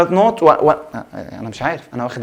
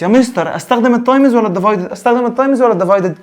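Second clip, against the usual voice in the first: higher, fast mock student asking; confused student; 6 semitones higher, 4 dB louder than usual. Teacher mimicking a confused student asking him. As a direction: whine it like a lost pupil. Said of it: "في ناس بتتلخبط".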